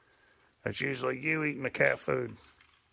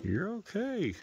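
high, soft, swooping reassurance; soothing pet talk; 10 semitones higher, 6 dB quieter, slower than usual. Real, not acted.

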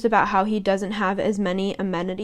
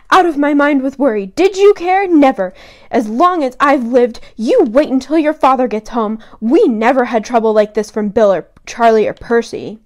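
loud, scolding rant; scolding mum; 2 semitones higher, 5 dB louder than usual. Retold or imitated. Mother scolding sons who took the car, loud. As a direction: tear into the boys like a furious mum. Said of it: "here's what she says".

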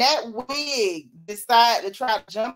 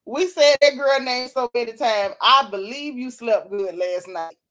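ordinary talk, nothing marked

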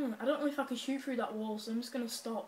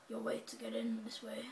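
hushed whisper, no voice; 7 dB quieter than usual. Real, not acted. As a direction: breathe it out as a whisper. Repeated in a whisper.

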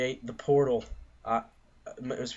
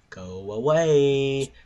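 loud slow plea; 3 semitones higher, 9 dB louder, much slower than usual. Real, not acted. Telling family to go away.

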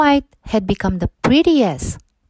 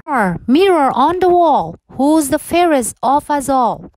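higher, grand demanding queen voice; queen to mirror; 6 semitones higher than usual. A narrator's reading. Vain queen demanding an answer from her mirror, grand.

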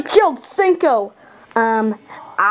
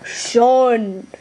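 solemn father voice, drawn out; solemn father figure; 3 semitones lower than usual. Acted. Father appearing to his son, solemn voice. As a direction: intone it as a grave dad.

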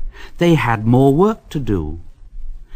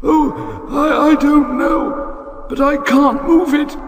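fretful engine. A narrator's reading. Henry afraid of the tunnel, high whimpering. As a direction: wail shoo, dying away.

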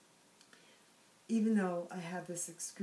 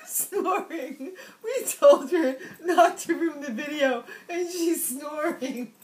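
words squeezed out through high laughter; can barely get it out for laughing; laughing helplessly; 8 semitones higher, 10 dB louder, slower than usual. Real, not acted. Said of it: "I, I'm laughing".

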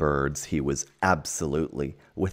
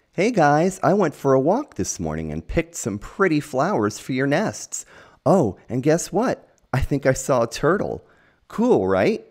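much higher and louder, bursting with glee; excited; 10 semitones higher, 7 dB louder than usual. Acted. Bob greets his bird friends, high excited gushing. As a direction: gush like you can't wait to share news. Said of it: "Bob was excited to see the birds".

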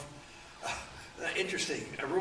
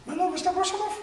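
much higher, louder imitated voice; 8 dB louder, much faster than usual. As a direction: play the little man calling, pitched way up. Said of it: "and I heard this".

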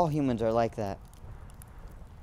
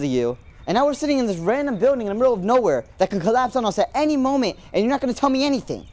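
high, louder exasperated rant; frustrated yelling; 6 semitones higher, 4 dB louder than usual. Acted. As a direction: vent your anger at the silent creature. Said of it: "I didn't mean to yell".

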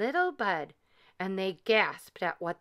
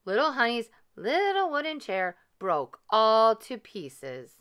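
usual speaking voice

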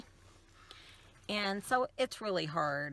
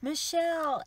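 mom calling out; 10 semitones higher, 6 dB louder than usual. Retold or imitated. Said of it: "she's like".

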